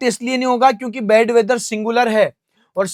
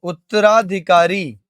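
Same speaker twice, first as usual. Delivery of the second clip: उत्तराधिकारी said slowly, syllable by syllable; slow repeated word; 2 semitones lower, slower than usual. Shown as a demonstration. Teacher repeating the Hindi meaning slowly for students. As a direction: spell out the sounds of उत्तराधिकारी slowly. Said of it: "इसका मतलब होता है उत्तराधिकारी".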